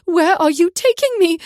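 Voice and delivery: high, loud, terrified pleading; captured woman panics at the guards, loud and high; panicked. A narrator's reading. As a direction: shriek it as panic sets in. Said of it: "pulled her to a standing position".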